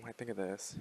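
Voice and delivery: ordinary talk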